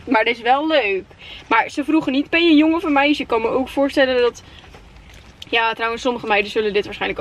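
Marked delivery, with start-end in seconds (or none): none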